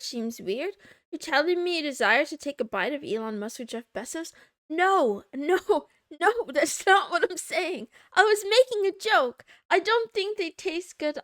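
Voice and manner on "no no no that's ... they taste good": flustered protest. Real, not acted. Protesting chat misread her joke.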